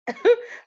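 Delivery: quick loud laugh that jumps way up; sharp high laugh. Real, not acted. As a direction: laugh at the awkward irony. Laughing at awkward memory.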